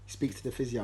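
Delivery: normal talking voice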